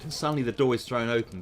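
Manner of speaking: usual speaking voice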